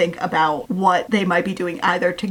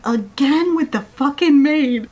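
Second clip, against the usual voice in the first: acted exasperation, higher-pitched; frustrated; 5 semitones higher than usual. Countess fed up at hearing about the maid, mock frustrated. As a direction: quote it as if fed up with the subject. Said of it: "I'm frustrated".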